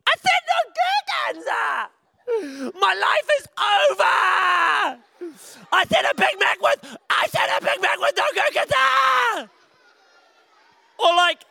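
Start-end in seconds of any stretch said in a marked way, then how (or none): 0.1-9.5 high, shrieking mock tantrum. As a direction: throw a hammed-up kid's tantrum over a burger. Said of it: "and then you're like".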